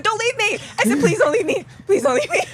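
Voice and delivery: high, loud pleading, half laughing, half crying; panicked begging. Retold or imitated. Athlete locked in porta potty begs the worker not to leave, high panicked plea. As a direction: beg him not to walk away, voice cracking with laughter.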